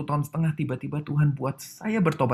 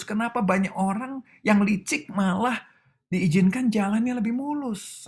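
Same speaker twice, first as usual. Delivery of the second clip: much higher, pleading and indignant; angry complaint to God; 10 semitones higher than usual. Retold or imitated. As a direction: hurl the grievance up at heaven. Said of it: "Saya marah sama Tuhan, saya bilang begini". (protes marah kepada Tuhan dengan nada tinggi)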